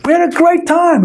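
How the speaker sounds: high, disbelieving protest; can't believe they're quitting; incredulous. Retold or imitated. To his students, stunned disbelief.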